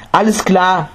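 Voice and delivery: high-pitched shout of agreement without much volume; eager call. A narrator's reading. Mother agreeing as she runs off, eager. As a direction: call back while dashing off. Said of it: "rief Kisagotami".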